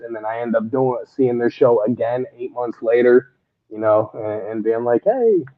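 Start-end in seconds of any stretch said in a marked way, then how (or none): none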